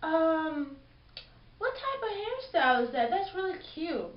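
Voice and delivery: lightly voiced polite request; polite asking. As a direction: ask nicely, sweetly. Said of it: "If I was gonna ask someone".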